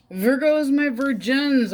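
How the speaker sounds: high, loud, drawn-out greeting; playful call. Real, not acted. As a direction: sing out a cheeky hello to the Virgos.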